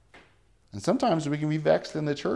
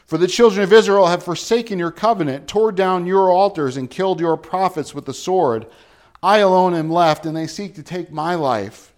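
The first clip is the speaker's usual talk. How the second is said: loud, anguished complaint, higher pitched; distressed; 4 semitones higher, 7 dB louder than usual. Acted. A prophet pleading his despair to God, loud and anguished. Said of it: "Do you hear the distress in him?".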